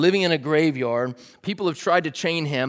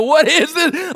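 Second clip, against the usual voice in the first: frightened cry; 11 semitones higher, 7 dB louder, slower than usual. Retold or imitated. Disciples hearing eerie noise.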